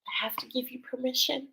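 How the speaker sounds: fast hushed whisper; soft whisper. Retold or imitated. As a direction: breathe the angels' words out softly. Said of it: "The angels just told me".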